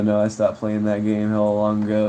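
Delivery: usual speaking voice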